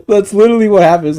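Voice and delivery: laughing fast and high. Real, not acted. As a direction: blurt it out through laughter. Laughing right after quote.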